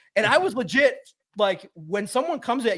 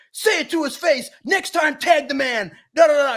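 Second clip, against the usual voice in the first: retold trash-talk, pitched up and heated; egging on from the back, higher and hot; mock yelling teammate; 7 semitones higher than usual. Teammate egging him on from the back, mock angry yelling. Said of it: "C D Deuce is in the back going".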